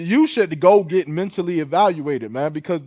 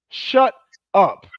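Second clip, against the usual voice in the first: louder shut up; 4 dB louder than usual. Acted. Telling the women he mocks to hush, loud and sharp. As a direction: bark the second take with more force.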